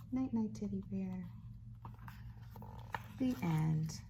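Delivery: bright sing-song read-aloud voice; reading to a toddler. Acted. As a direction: read it like to a little one. Reads a picture book to baby.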